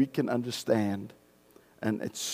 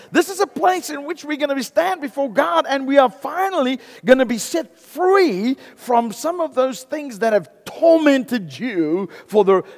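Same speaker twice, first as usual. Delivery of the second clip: high, loud pulpit preaching, slowing down; rising sermon climax; preaching at full force; 9 semitones higher, 7 dB louder than usual. Acted. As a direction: lift it up and drive it home.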